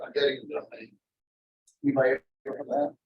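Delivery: ordinary talk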